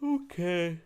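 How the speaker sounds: spoken through a yawn; words stretched by a yawn. Real, not acted. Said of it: "Yawning over here".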